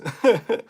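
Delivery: quick rising chuckle; a brief, amused laugh; short laugh. Real, not acted. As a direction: laugh it off, a bit sheepish. Laughs at own rude remark.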